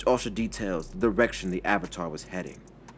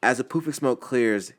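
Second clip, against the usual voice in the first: plain reading voice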